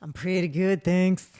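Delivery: low, deep-voiced reply; deep firm voice. Real, not acted. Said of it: "Your voice sounds kinda deep".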